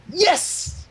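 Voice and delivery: high, grand declaration; dramatic Cadance. Acted. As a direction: declare it like a stage reveal. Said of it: "declared Cadance dramatically".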